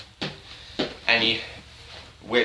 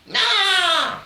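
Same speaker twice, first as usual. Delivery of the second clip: sudden, much higher cartoon shriek; high mock scream. Narrating Dracula wiping everything out, high mock shriek. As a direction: squeal out a silly battle cry.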